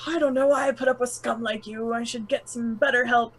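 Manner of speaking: ordinary talk, nothing marked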